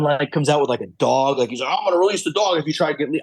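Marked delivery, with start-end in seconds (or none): 1.7-3.2 fast, higher-pitched retold threat; hillbilly son. Hillbilly son threatening to set the dog loose, lightly mimicked. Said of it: "like, hillbilly son".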